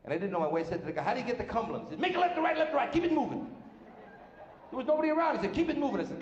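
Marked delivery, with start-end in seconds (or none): 2.0-3.5 high-pitched mock airport traffic guard; rattled-off orders, pitched way up; brusque traffic directions. Airport guy giving brusque directions. 5.5-6.0 high-pitched mock airport guard; insistent order in a high put-on voice; brusque traffic order. Repeats the airport guy's order.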